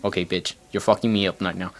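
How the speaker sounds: ordinary talk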